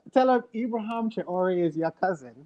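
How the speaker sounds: put-on African accent. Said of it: "you gotta do it in an African accent".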